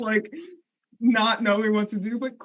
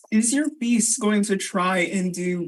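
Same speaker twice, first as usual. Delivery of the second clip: ordinary talk, nothing marked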